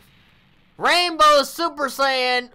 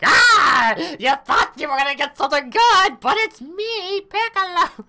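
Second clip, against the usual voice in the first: high, loud hammed-up villain reveal; mock Piccolo; 8 semitones higher, 4 dB louder than usual. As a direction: gloat as the character popping out. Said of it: "He's like".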